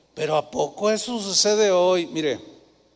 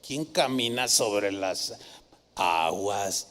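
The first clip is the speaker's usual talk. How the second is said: low scoffing mock voice; mocking skeptic; 11 semitones lower, 8 dB quieter, much slower than usual. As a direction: mimic a know-it-all doubter. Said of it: "los que explican todo dirían". (voz burlona y grave de escéptico)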